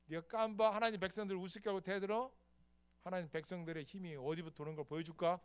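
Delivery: put-on brash, defiant young fighter; David taunting Goliath. David taunting Goliath before battle, brash. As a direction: taunt the giant, fearless. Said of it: "내가".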